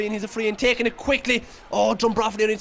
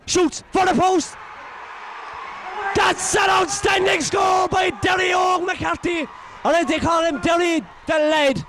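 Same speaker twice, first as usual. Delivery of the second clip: higher, slow, stretched celebratory call; excited score call; 7 semitones higher, slower than usual. Real, not acted. Commentator hails McCarthy's score, drawn-out and high. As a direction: let the goal call soar and ring out.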